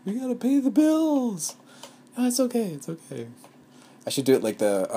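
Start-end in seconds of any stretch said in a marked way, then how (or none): 0.1-1.6 high, strained panicked squeal; the worried money side shrieking; panicked inner voice. Pretend. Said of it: "part of myself is, you know, screaming". 2.2-2.7 high, squeaky hurried reassurance; reassuring. Pretend. Soothing her panicked side. 2.7-3.3 quiet, gentle calming back down; soft reassurance. Pretend. Soothing her panicked side.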